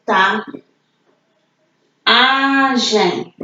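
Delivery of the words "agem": Agem stretched on the A, AAA-GEM; drawn-out word ending. Shown as a demonstration. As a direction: draw out both syllables of Agem. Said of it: "Palavras terminadas em agem".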